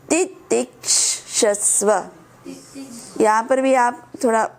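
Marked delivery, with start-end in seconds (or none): 0.1-2.0 "तितिक्षस्व" slowly, broken into separate syllable groups; slow, spaced-out "तितिक्षस्व", ti-tik-sha-sva; तोड़कर उच्चारण. Shown as a demonstration. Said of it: "अलग कर देना इसको". ("तितिक्षस्व" को धीरे-धीरे टुकड़ों में अलग करके)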